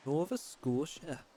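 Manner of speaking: slow, badly faked Canadian accent on "Nova Scotia", open vowels; mock a Canadian saying "Nova Scotia", drawn out; terrible Canadian accent. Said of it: "That was a terrible Canadian accent".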